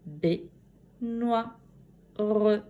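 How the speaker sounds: "Baignoire" as three separate beats, bai, gnoi, re; syllable by syllable. Shown as a demonstration. Teacher recapping the syllables, "Baignoire" in three pieces. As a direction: break the word after each syllable. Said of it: "dans la deuxième syllabe".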